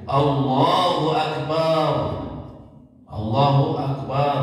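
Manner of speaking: Akbar with the ba stretched out, slow; long ba in Akbar. Shown as a demonstration. Teacher demonstrates the wrongly lengthened ba. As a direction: drag out the b of Akbar. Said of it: "memperpanjang babnya".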